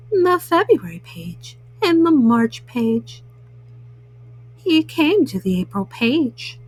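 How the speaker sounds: gentle, unhurried read-aloud for little ones; bedtime story reading. A narrator's reading. Mama Bunny reading Frog and Toad to children, gentle storytelling. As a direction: read it like a bedtime picture book. Reads picture book to children.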